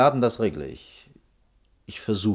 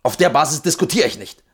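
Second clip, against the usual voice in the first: angry cutoff; 8 semitones higher, 6 dB louder than usual. Acted. Official cutting off the architect's rant, loud and angry. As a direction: shut her down hard. Official cuts off heated argument.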